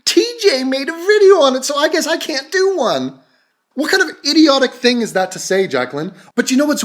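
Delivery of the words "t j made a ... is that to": high-pitched exasperated rant; incredulous, indignant mockery; scornful outburst. Real, not acted. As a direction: cut in, pitched high with disbelief. Scornful outburst at the clip's excuse.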